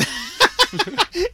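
high loud cackle; burst of laughter. Real, not acted. Teller remembering the funny workshop, loud laugh. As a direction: crack up completely.

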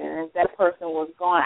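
ordinary talk

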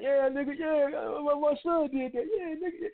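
high-pitched mock celebration; hammed-up, high-voiced bragging; imitated proud cheering. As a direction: cheer like a dad high-fiving his buddy. Imitating fathers high-fiving over sons' conquests.

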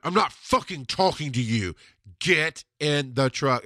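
slow, hard repeat of the order; angry command. Retold or imitated. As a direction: cut him off and lay down the law. Snapping at man, repeating order.

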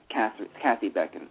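ordinary delivery

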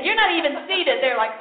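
usual speaking voice

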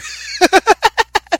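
high, loud laugh; burst of laughter. Real, not acted. Reader noticing this week's cards match last week's, laughing loudly. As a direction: laugh out loud, caught by surprise.

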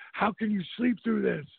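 half-mocking frantic friend; panicked buddy. Panicked Rob, bombs at night.

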